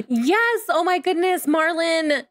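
high-pitched, delighted celebration; excited cheer. Real, not acted. Player celebrating Marlon's potty level, excited cheer.

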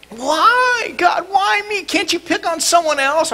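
Nancy Kerrigan wail. Retold or imitated. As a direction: wail like a hurt victim. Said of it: "I did a Nancy Kerrigan".

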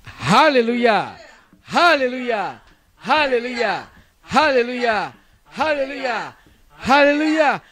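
loud rising chant of praise; shouting. Real, not acted. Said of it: "大声で叫びましょう".

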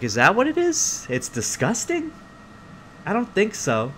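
high-pitched puzzled questioning; doubtful child. Retold or imitated. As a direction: question it like an unconvinced kid.

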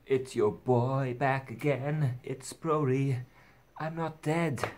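slow, drawn-out YouTuber hello; hyped intro voice. Acted. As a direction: greet the viewers like a big return.

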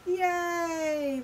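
high, drawn-out hooray; cheering. A narrator's reading. Crowd seeing Big Bark freed, cheering. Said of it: "the crowd cheered".

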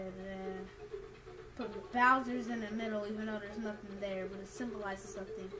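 normal talking voice

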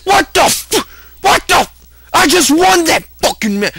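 shrieking high, shocked disbelief; shocked outburst. Real, not acted. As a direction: yelp it out, stunned. Sudden loss in the game.

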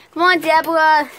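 loud holler to a companion; play-acted impatient call. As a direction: hurry a friend along loudly. Calling a companion along.

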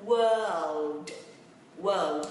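WORLD said loud with a dark L before D; tongue up for L. Shown as a demonstration. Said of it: "thế giới thì quánh chữ L lên ha".

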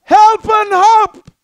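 loud, rising preacher's declaration; triumphant proclamation. Acted. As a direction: proclaim the good news. Triumphant proclamation of promised help.

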